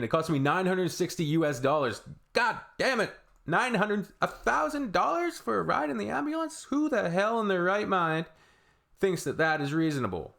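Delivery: high, incredulous outrage at the cost; rising fury, pitch climbing high; exasperated high-pitched disbelief; outraged rant. Real, not acted. Outraged at ambulance bill.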